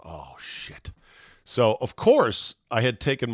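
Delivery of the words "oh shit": breathless near-whisper oh shit; hushed dread. Retold or imitated. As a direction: mutter it under your breath in dread. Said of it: "I'm just going".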